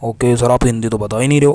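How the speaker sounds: a loud mock protest from a pupil; hammed-up student grumbling at sir; student loudly complains about no Hindi; complaining student. Teacher voices complaining student.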